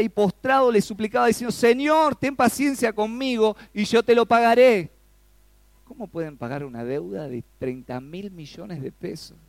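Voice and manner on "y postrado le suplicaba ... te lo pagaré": high, loud, pleading delivery; scripture read with raised passion; impassioned reading. Shown as a demonstration. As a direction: read it like a desperate plea. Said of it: "Digamos, cuando leemos la Biblia".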